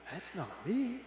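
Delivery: rising to a high, loud cry of wonder; astonished and overjoyed, building to a shout; amazed. Acted. Teacup seeing its reflection in the mirror, astonished.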